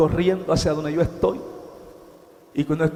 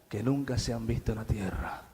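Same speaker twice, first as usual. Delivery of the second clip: hushed whisper; 8 semitones lower, 11 dB quieter than usual. Acted. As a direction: drop to a whisper for the congregation. Preacher whispers horror.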